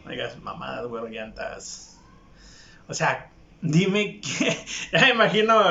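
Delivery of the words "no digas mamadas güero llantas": whispered, much softer retort; quiet scoff to yourself; muttered aside. Retold or imitated. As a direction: mutter it under your breath. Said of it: "como que yo digo".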